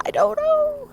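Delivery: high, squeaky caught-out reply; awkward shrug. Retold or imitated. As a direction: play dumb with a sudden high squeak. Awkward reply to upset neighbor.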